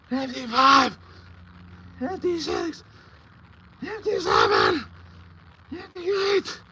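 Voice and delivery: strained, high and loud, shouting each number out through the effort; straining rep count. Real, not acted. Calling out reps to the stream as the set gets brutal, straining shouts. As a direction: force every count out through the burn.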